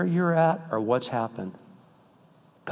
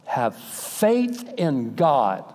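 slow solemn pronouncement; 4 semitones higher, much slower than usual. Pretend. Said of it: "I'm gonna go".

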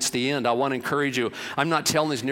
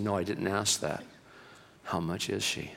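low, soft, measured repeat; firm, quiet insistence; 6 semitones lower, 9 dB quieter than usual. A narrator's reading. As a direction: press the question again quietly. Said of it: "He said".

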